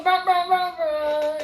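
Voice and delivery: loud high mouth-made air horn; DJ horn imitation. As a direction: blare it like a DJ's horn. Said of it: "here go the D J".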